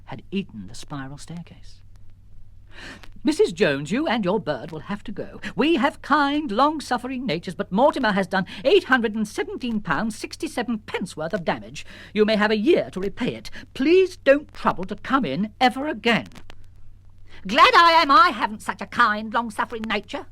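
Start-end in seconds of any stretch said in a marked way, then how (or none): 3.2-16.3 higher, prim and stiffly polite; prim shopkeeper. Acted. Shop owner sacking Missus Jones, prim and icy. 17.5-20.2 higher, louder indignant retort; snapping back. Acted. Missus Jones retorting to the shop owner, snapping. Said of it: "snapped Missus Jones".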